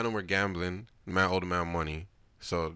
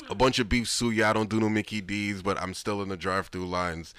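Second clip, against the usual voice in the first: hyped up; 3 semitones higher, 9 dB louder than usual. Real, not acted. Hyped [name] rant, loud.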